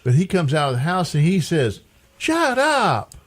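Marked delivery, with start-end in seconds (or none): 2.2-3.1 high disbelieving shut up; incredulous shut up. Retold or imitated. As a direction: say it like you can't believe it. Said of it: "It means, you gotta be kidding me".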